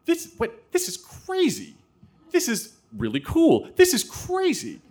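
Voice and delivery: very high-pitched silent-scream squeal of amazement; excited inner freak-out. Retold or imitated. Twelve-year-old privately amazed at news of a TV story, excited inner freak-out. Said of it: "inside I'm going".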